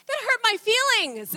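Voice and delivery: high-pitched mock child's whine; hurt little son. Son to mother after being told no, mock hurt. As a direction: imitate a child's wounded protest. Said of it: "he will look at me and just go".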